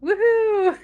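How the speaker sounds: high, loud woohoo; excited cheer. Shown as a demonstration. Showing celebratory exclamations.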